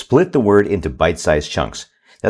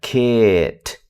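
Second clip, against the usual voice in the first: very slow blending. Shown as a demonstration. Parent showing a child how to blend, very slow. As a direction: stretch kit out very slowly. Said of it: "Very slow".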